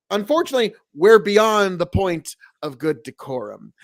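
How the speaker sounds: usual speaking voice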